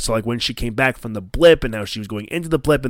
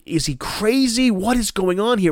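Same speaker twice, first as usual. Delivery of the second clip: higher, wondering questions; puzzled inner voice; 8 semitones higher than usual. Retold or imitated. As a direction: ask yourself in disbelief. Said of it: "you think, like".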